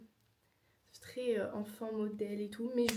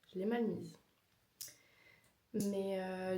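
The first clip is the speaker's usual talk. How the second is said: normal talking voice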